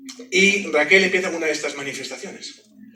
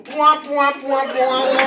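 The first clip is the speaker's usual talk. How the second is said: imitación de un músico; 8 semitones higher, 7 dB louder, much slower than usual. Retold or imitated. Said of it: "como diría el famoso músico".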